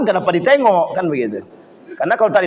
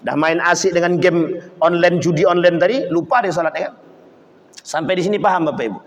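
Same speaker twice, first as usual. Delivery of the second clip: usual speaking voice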